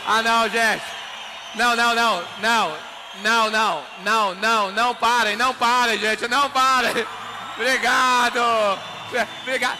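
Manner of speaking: loud, happy shouting over cheers; loud over applause. Real, not acted. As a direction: thank the roaring crowd. Basking in applause.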